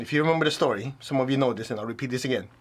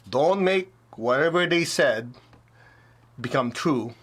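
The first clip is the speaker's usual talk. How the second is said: ordinary talk, nothing marked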